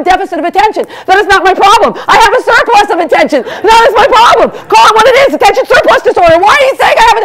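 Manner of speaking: comic rant about attention surplus. Real, not acted. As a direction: get riled up defending yourself. Ranting about ADD label.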